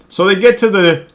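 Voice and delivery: ordinary delivery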